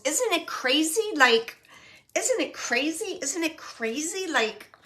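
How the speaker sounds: high-pitched, incredulous quoting; acted-out exasperated wonder; relayed disbelief. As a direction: exclaim it in imitated amazement, pitch lifted.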